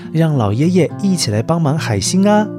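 usual speaking voice

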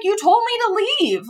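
high-pitched retold protest; indignant retort. Traveler answering back to the security officer, indignant. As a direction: argue back, pitched well up.

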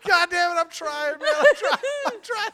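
high, squealing through laughter; helpless laughing protest. Real, not acted. Host failing at an impression, laughing helplessly in protest. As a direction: protest while cracking up.

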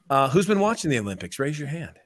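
ordinary talk

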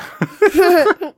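high-pitched laugh; high burst of laughter. Real, not acted. Big laugh at joke.

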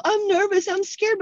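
high-pitched mock-anxious voice; needy energy vampire. Worrier telling people her fears, high mocking imitation. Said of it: "that's, like, energy vampirish".

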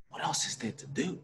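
whispered to himself in disbelief; stunned, unvoiced whisper of the words; awed whisper. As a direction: breathe the question as it sinks in. Stunned, repeating the answer to himself.

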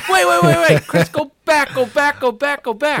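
high, loud, urgent cutting in; rapid-fire high-pitched protest; alarmed interruption. Retold or imitated. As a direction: frantically halt the storyteller in mock alarm. Acts out his alarmed interruption.

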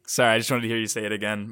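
usual speaking voice

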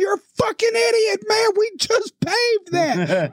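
imitated furious worker, high pitch; outraged yell, stretching out the end; vehement screaming. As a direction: berate the culprit in a high mock shout. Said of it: "vehemently screaming at me".

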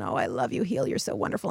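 low, hushed, breathy murmur to the heel; soft self-love. Shown as a demonstration. Coach demonstrating loving self-talk, hushed low murmur. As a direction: drop down to a soft, half-whispered caress. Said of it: "gently touch your heel".

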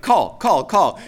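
calling out. Acted. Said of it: "It's like".

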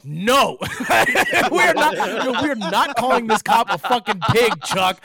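laughing yell to shut his friend up, high and loud; high, loud panicked telling-off through laughter; frantic loud scolding. Retold or imitated. Comic scolds his friend in the car, loud and laughing.

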